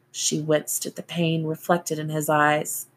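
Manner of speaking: ordinary delivery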